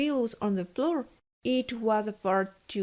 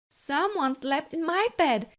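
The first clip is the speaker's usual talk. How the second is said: higher, moderate mama bear; a motherly bear, a touch raised; Mama Bear's voice; 6 semitones higher than usual. A narrator's reading. Narrator voicing Mama Bear seeing her pillows, higher. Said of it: "in her average voice".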